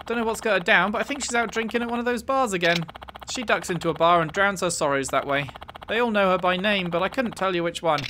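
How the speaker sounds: a touch of bar-worker gossip about a regular; bar hostess. Acted. Voices bar worker's dialogue.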